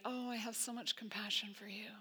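mock-sweet whispered platitude; fake nicety. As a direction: breathe it out like hollow sympathy. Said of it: "not just fake nicety".

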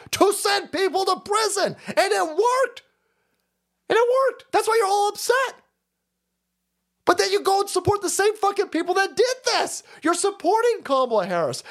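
high, heated shout of outrage; rising rant. Real, not acted. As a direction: build it into an angry tirade. Ranting against the establishment.

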